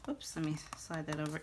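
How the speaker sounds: fast whispered oops to herself; quick whisper. Real, not acted. Crafter whispering a quick correction while sliding a layer. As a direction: hurry the fix under her breath.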